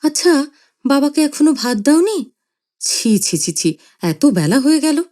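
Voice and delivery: tut-tutting reproach; a husband chiding gently; reproachful. Acted. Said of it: "বাবা মাকে অনুযোগ করলেন".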